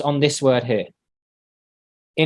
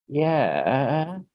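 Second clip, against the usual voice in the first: soft, drawn-out doubtful yeah, rising; not understanding; 6 dB quieter than usual. Acted. Student answers teacher's do you understand, unsure and drawn out. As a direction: agree without having understood. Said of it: "They didn't understand".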